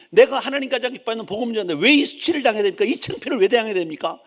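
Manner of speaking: high, loud acted grievance; hammed-up indignant rant; losing temper. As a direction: protest to God like an offended believer. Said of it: "막 승질 나는 거야".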